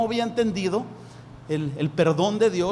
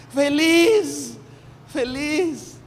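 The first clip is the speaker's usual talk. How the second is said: joyful; 7 semitones higher, 5 dB louder, much slower than usual. Acted. Preacher describes joy.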